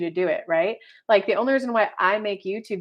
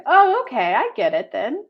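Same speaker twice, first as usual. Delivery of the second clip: mom understanding; 3 semitones higher, 4 dB louder than usual. Retold or imitated.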